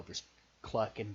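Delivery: ordinary talk, nothing marked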